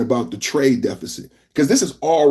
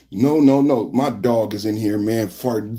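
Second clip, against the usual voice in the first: ordinary talk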